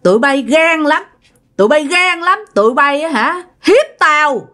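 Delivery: put-on possessed old woman denouncing her killers, high and fierce; possessed accusing voice. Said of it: "Bà mới nói rằng".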